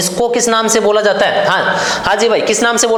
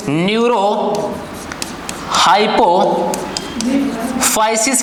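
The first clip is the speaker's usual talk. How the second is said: Neurohypophysis stretched very slowly, syllable by syllable, softer, rising at the end; 4 dB quieter, much slower than usual. Shown as a demonstration. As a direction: dictate neurohypophysis slowly so every syllable is heard. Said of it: "इसको बोला जाएगा". (Neurohypophysis को बहुत धीरे, हर अक्षर अलग करके, धीमी आवाज़ में)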